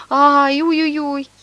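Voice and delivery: high, loud mock howl; hammed-up wail. Swindler howls for pity, high mock wail. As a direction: wail it out like a fake mourner. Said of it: "И он ещё хуже завыл".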